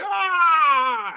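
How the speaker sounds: long high-pitched cry of pain; drawn-out hammed-up howl; mock crying wail. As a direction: wail like he broke his arm. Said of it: "And he's crying and shit".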